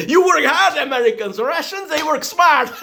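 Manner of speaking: high laughing mockery; laughing taunt. Taunting Americans who work hard, laughing high. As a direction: laugh it in their face.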